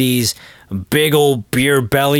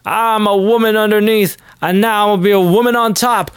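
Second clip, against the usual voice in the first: mock triumphant man in a dress; 7 semitones higher than usual. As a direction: announce the new self with mock pride. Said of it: "And then he's like".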